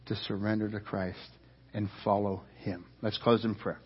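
low, soft, earnest appeal to the congregation; hushed sermon close, measured pauses between phrases; quiet altar call. Real, not acted. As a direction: bring it down and invite them gently. Quiet closing appeal after story.

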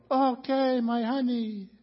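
breezy happy bride. Retold or imitated. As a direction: float in without a worry. Late bride arrives happy.

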